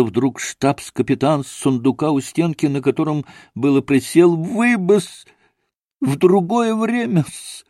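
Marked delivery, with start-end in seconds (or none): 4.4-7.6 high, timid stammering protest; flustered babble. A narrator's reading. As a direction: plead meekly, pitched up. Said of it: "пролепетал он".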